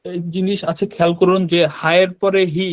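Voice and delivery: normal talking voice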